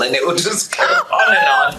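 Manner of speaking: laughing loud and high. Real, not acted. Talk-show guest laughing about a wordy friend. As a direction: break into a big laugh mid-sentence.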